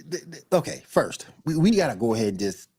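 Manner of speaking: normal talking voice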